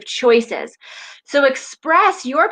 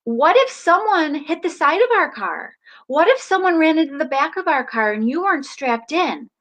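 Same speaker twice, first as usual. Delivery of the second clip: firm parental warning; 6 semitones higher than usual. Retold or imitated. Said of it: "I said".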